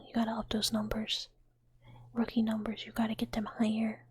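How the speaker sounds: ordinary talk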